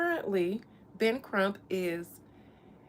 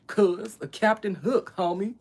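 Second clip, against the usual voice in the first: Cali voice. Pretend. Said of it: "Why am I talking in a Cali voice?".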